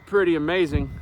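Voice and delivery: usual speaking voice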